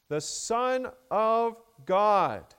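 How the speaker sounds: drawn out and loud, with weight on "God"; emphasis on God. Shown as a demonstration. Preacher showing the congregation where the verse's weight falls, "God" stressed. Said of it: "the emphasis there is on God".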